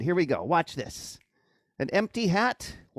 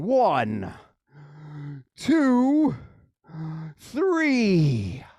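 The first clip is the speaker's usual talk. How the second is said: drawn-out rising magic countdown; kids party magician; much slower than usual. Acted. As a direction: build suspense on each number.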